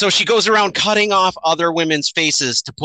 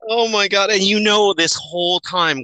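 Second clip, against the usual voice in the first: ordinary delivery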